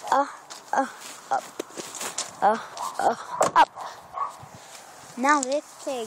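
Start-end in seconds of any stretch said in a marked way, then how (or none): 0.1-3.7 short separate "uh, uh" before "up"; short vowel demo. Shown as a demonstration. Child teacher showing viewers the letter sounds, short vowel demo. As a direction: chop out "uh" twice, then "up". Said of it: "U makes the short sound".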